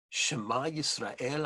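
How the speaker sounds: usual speaking voice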